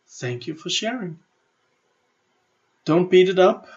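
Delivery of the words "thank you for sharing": gentle, quiet thanks to the inner voice; soft thank you. Shown as a demonstration. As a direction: thank it softly, kindly. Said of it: "So just say".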